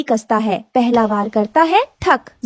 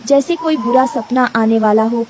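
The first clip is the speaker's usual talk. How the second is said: usual speaking voice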